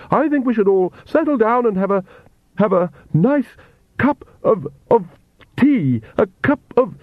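high, halting, fluttery voice; flustered Countess. Acted. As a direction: stumble nervously through a soothing suggestion, pitch way up. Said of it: "The Countess fanned herself desperately".

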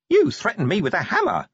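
a tiny imp pointing the finger; accusing imp. Acted. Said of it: "said the imp accusingly".

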